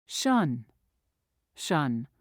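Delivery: normal talking voice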